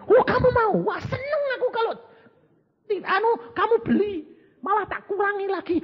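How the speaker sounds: high, gushing and quick; delighted rich lady. Acted. Rich lady on the phone gushes, high and fast. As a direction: squeal with pleasure at the offer.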